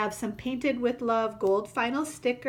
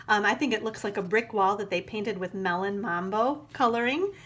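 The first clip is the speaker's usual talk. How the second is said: ordinary delivery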